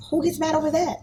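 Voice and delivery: high, disbelieving rhetorical question; incredulous retort. Retold or imitated. Mother recalling her reaction to daughter, disbelieving. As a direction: scoff at how silly it is to get angry. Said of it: "I got upset because I'm like".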